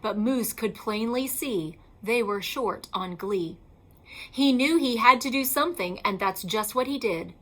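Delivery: usual speaking voice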